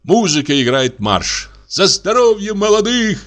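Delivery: high, ringing toast shouted over the room; the host shouts a toast to the newlyweds and calls for music; shouting a toast. A narrator's reading. Shouted toast. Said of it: "Кричит".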